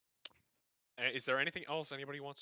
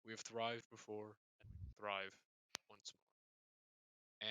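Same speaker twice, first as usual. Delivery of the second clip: king's solemn speech; 3 semitones lower, 12 dB quieter than usual. Acted. King to loyal subjects on festival night, solemn address. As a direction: address the subjects gravely and quietly, unhurried. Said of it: "The king begins to speak".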